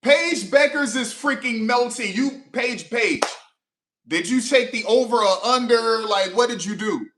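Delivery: high, loud demands at the player; exasperated yelling. Real, not acted. Fan yelling at the player, exasperated and loud. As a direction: shout the questions straight at her.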